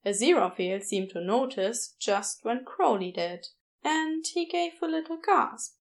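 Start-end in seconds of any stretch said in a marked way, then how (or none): none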